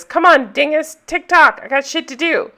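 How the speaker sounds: lightly acted pushy impatience; impatient. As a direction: imitate a jerk rushing someone along. Retold jerk hurrying the woman along.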